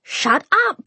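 high, exasperated yell; fed-up, high-pitched holler; yelling upward. A narrator's reading. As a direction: yell it at the neighbours upstairs in a high voice. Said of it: "Bofa yelled at the ceiling".

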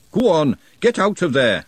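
loud shooing yell. A narrator's reading. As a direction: shout up into the pipes. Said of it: "she yelled".

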